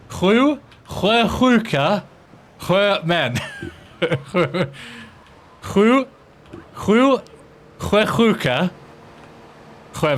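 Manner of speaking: attempted Swedish. Shown as a demonstration. Non-native streamer trying the Swedish tongue twister, halting Swedish. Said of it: "Hear the Swedish one".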